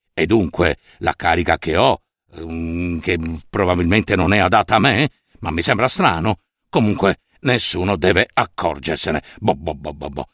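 low, hesitant murmur to himself; nervous official thinking. A narrator's reading. Official secretly doubting his own wits, low and hesitant. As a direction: reassure yourself without conviction. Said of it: "pensò il funzionario".

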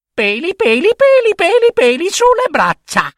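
very high mocking child voice with giggles; high sing-song teasing rhyme; teasing little girl. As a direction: taunt the monster cheekily. Girl's taunt.

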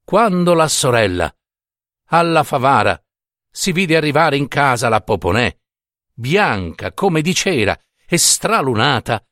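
ordinary delivery

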